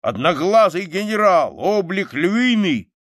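put-on high, loud, excited old soldier; soldier's rousing war tale; loud bragging. A narrator's reading. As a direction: brag with rising pride, voice well up. Soldier boasts loudly of his service.

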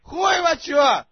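high, slow, very loud yell; frustrated shout. Real, not acted. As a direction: blast it out in exasperation. Frustrated shout at deaf listener.

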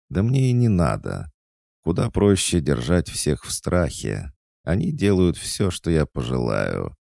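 a hint of a spoiled girl boasting coldly; haughty young girl. A narrator's reading. Proud girl lightly voiced, boasting to the old witch.

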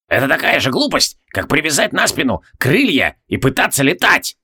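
put-on old alchemist's dismissive scorn; contemptuous. A narrator's reading. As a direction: ridicule the idea in a put-on old voice. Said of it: "презрительно отмахнулся Себастиус".

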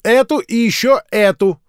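put-on high, loud bossy child; little girl giving brisk commands; commanding. A narrator's reading. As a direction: order the others about. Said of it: "командовала мышка".

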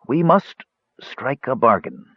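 put-on high, labored, slow old mage; slow, with difficulty. A narrator's reading. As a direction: drag the words out with effort. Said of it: "said slowly, with increasing difficulty".

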